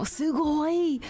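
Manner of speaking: high, bright Japanese "sugoi"; imitating Japanese. Streamer quoting a Japanese streamer, Japanese accent. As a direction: chirp "sugoi" like an anime character. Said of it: "like when he would say".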